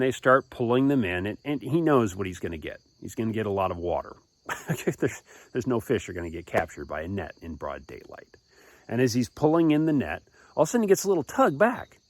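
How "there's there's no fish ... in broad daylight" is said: ordinary delivery, nothing marked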